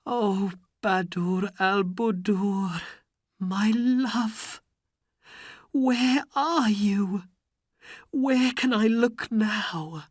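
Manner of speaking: high, slow longing call for a lost wife; calling out by the river; a touch of storybook sorrow; lament. Acted. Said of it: "he sat by a river and cried".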